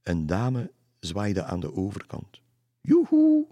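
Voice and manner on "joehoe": loud, very high yoo-hoo; calling out. A narrator's reading. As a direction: hail someone across the river in a high singsong. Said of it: "riep ze". (luid en hoog roepen)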